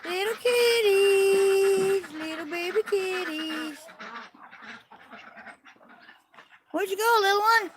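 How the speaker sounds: slow, drawn-out cooing. Real, not acted. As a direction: coo tenderly at hidden kittens. Cooing at kittens.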